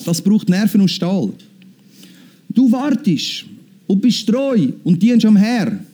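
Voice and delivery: normal talking voice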